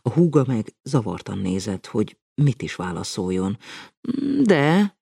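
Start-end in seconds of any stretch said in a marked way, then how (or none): 4.4-5.0 gaining courage. A narrator's reading. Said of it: "bátorodott fel a mondat végére".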